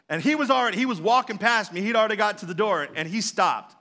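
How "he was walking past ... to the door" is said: usual speaking voice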